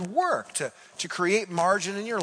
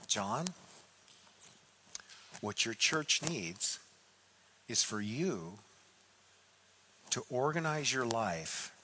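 very calm; 6 semitones lower, 8 dB quieter than usual. Retold or imitated. Old mentor quietly counsels the frustrated pastor, calm and soft. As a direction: settle back and let each phrase land gently. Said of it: "He sits back and he very calmly says".

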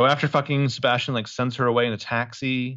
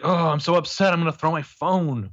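higher-pitched imitation of frustration; mock upset; 5 semitones higher than usual. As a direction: act out a little tantrum, half in jest. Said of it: "like, out of anger?".

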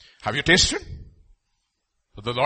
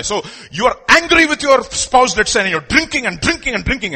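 emphatic preaching; 9 semitones higher, 6 dB louder than usual. Real, not acted.

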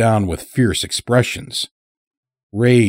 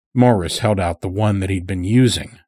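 ordinary delivery, nothing marked

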